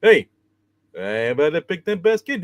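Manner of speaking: lightly voiced cartoon bear; Yogi Bear voice.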